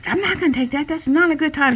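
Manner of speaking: high, overwhelmed mock protest; imitated mom, high and flustered; panicked refusal. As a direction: push the call away in alarm. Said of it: "the mom was like".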